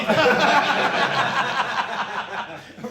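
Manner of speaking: long, loud burst of laughter; roaring laughter at the joke; big laugh. Real, not acted. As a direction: laugh hard at the punchline. Audience laughs at punchline.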